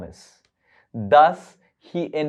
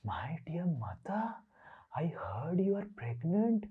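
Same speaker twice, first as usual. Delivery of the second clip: much softer whispered confiding; sly whisper; 2 semitones higher, 13 dB quieter than usual. Acted. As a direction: whisper sweetly, as if sharing a secret. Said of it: "then Indra said".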